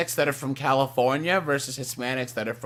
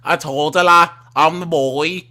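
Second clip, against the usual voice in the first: mock Scouse accent; thick imitation Scouse; Liverpool accent; 2 semitones lower, 4 dB louder than usual. Shown as a demonstration. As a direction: do a hammed-up Liverpool voice. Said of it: "a Liverpool".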